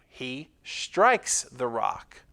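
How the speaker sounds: ordinary talk, nothing marked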